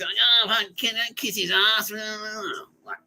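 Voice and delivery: high, drawn-out whiny mimicry; hammed-up high mock voice; mocking sneer. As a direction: imitate a sarcastic whinger, high and slow. Mimics bandmate's sneer in story.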